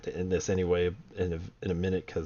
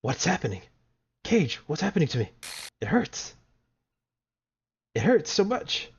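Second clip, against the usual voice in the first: high, pleading cries for help; pained game girl; 10 semitones higher, 5 dB louder than usual. Acted. Reading her lines aloud, a high panicked plea for help. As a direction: beg the player to save you, voice climbing. Voices the game character in pain.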